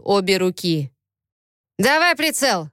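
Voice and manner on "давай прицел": sharp high command; barked order. Acted. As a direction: bark it like an order. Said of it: "гаркнул Виктор". (отрывистый окрик)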